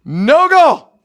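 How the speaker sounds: loud, high cry of the ruling; excited call. Real, not acted. Host repeating referee's ruling, loud high excited call. Reacts to overturned goal.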